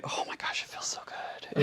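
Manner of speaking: soft, whispered swoon; hushed delight. Real, not acted. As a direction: whisper it, lost in the soft fabric. Savoring the soft fabric's feel.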